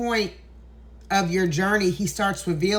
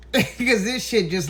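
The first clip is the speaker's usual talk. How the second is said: laughing. Real, not acted. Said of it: "I hope I don't get emotional".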